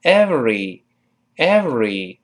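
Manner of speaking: slow "every" with a clear buzzing v; touch upper teeth lightly to lip for the v in "every"; correct v sound. Shown as a demonstration. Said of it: "注意上牙轻轻接触下唇". (“every”慢读，v用上牙轻触下唇)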